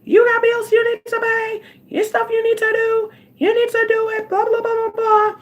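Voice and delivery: high nagging voice scolding herself; mock her own inner nag, high. Vlogger mimics the nagging voice in her head, high and shrill.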